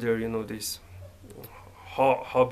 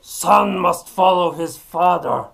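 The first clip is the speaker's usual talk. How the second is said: a louder, higher mock-stern father line; stern father's demand; 6 semitones higher, 8 dB louder than usual. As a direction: bellow it like a demanding old-school dad. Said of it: "always demands that".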